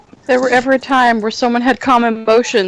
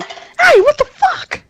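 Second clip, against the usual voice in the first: high-pitched startled scream; much slower than usual. Shown as a demonstration. Player showing the reaction to a spear hit, screaming. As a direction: yelp it out way up high. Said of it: "I assume that would be screaming".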